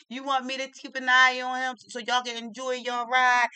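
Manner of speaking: loud, high helpful-lady voice; lady playing along. Lady plays along with mother's bluff, loud showy offer. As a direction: offer it loudly for the child to overhear. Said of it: "She playing right in with it".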